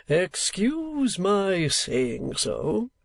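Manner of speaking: very slow, courtly showman; theatre manager voice. A narrator's reading. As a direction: ease in politely, very slowly. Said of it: "said the manager".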